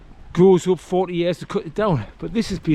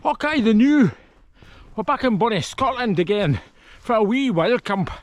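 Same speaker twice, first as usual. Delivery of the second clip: put-on broad, jokey Scottish accent, higher in pitch; 4 semitones higher than usual. Vlogger greeting viewers back at camp in a hammed-up Scottish accent. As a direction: greet them like a cheeky Scotsman, in a Scottish accent. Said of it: "this stupid, ridiculous accent I do".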